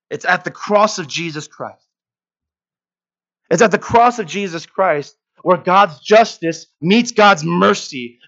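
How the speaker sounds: high, loud, driving pulpit voice; preaching at full force. Acted. Preacher rouses the congregation about the cross, high and loud. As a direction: hammer each question at the congregation.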